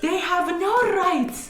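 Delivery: furious mom. Retold or imitated. Mom ranting at police, retold.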